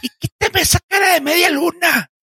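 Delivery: high shouted, indignant yelling; an angry man shouting insults at a worker. Retold or imitated. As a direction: yell like an outraged customer. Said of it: "me estaba insultando".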